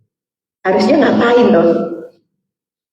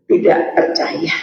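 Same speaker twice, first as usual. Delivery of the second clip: whispered answer; 5 semitones lower, 4 dB quieter, slower than usual. Real, not acted. Answering own question.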